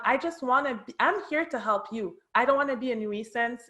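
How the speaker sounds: ordinary talk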